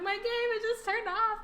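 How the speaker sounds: high, slow, loud mock wail; mock crying child. Child telling mom the game died, mock wailing. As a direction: whine it like a sobbing kid. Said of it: "I was, like, crying".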